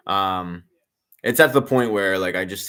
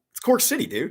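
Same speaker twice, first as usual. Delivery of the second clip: high-pitched mock reply, pleased with himself; mock high retort. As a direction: squeak it out as a cocky retort. Retelling own reply to player.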